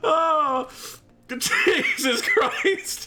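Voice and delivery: bursting into high laughter, then a slow laughing Jesus Christ; startled laughter. Real, not acted. Gamer laughing at a sudden boss attack, high and slow. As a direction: laugh it off after a scare.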